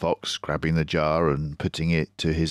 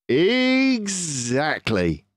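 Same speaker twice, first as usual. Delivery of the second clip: slow, somewhat higher fox voice; Mister Fox; 11 semitones higher, 3 dB louder, much slower than usual. Acted. Mister Fox agrees with his son, pleased. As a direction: savour the word.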